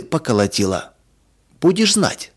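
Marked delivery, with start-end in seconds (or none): 1.6-2.3 high-pitched cross parting shot; mildly angry. A narrator's reading. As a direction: toss out a scolding warning. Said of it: "сердито бросила она".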